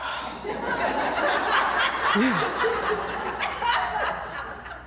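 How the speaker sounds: long airy real laugh, high and voiceless; breathy laugh. Real, not acted. Coach laughs at the panicked trainer, long breathy laugh.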